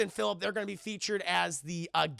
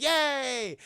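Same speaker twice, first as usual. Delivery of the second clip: mock cheer; 6 semitones higher, 4 dB louder than usual. Acting out Conan audience cheer.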